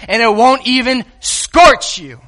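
ordinary talk

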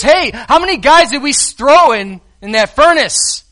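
a hint of an astonished king; king's question. King asking his guard, mildly alarmed.

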